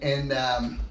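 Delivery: usual speaking voice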